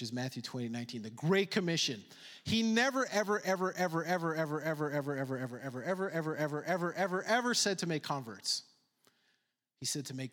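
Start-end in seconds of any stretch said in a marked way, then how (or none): 2.4-8.0 every ever leaned on, a long sermon build; emphatic never ever. Acted. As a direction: hammer the ever over and over. Preacher repeating ever for emphasis.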